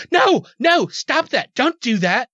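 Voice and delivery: high-pitched mock scolding; high, fussy protest, acted out; scolding woman. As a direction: imitate a woman frantically objecting from afar. Acting out the waving woman's objection.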